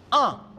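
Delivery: "Un" said as a nasal vowel, not "en"; teacher correcting to "Un"; correct pronunciation. Shown as a demonstration. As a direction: give the right nasal "Un", clearly and firmly. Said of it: "se pronuncia un".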